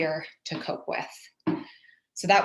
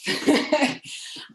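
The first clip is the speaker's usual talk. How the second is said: laugh; 9 semitones higher than usual. Real, not acted. Laughs at being out of breath.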